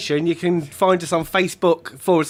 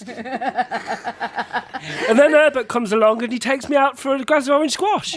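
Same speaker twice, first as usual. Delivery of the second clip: ordinary delivery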